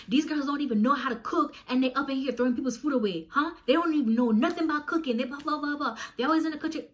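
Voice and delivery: loud, high indignant rant; retold screaming rant. Ranting so roommates in the room hear, loud. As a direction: reenact yelling so the whole house hears. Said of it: "So I started screaming in the house now".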